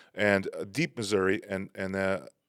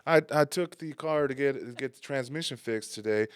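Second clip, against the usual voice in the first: chatty local; 5 semitones higher than usual. Retold or imitated. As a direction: imitate a stranger earnestly sharing his day. Acting out chatty Missouri man.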